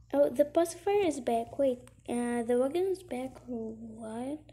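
usual speaking voice

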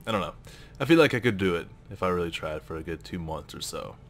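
ordinary delivery

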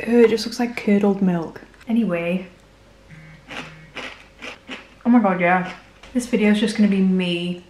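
talking while chewing; covering mouth while eating; mouth full of cereal. Real, not acted. Seen 3: Spoonful at -2; hand over mouth, chewing until about 2.8s.

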